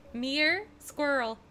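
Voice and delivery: fond American accent imitation. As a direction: mimic an American "mirror", squashed to "meer". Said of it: "favorite things to hear Americans say".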